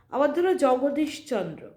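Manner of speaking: ordinary talk, nothing marked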